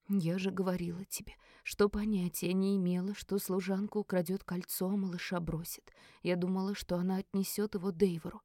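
subdued, defensive murmur; Kara, hushed self-justification. Acted. Кара оправдывается вполголоса.

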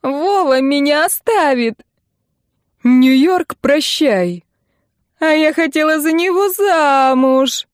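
high, loud, drawn-out lament; hysterical wailing. A narrator's reading. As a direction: howl over lost love, building to a peak. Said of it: "Хватит выть!". (истерично воет)

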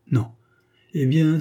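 plain reading voice

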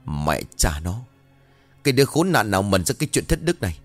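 usual speaking voice

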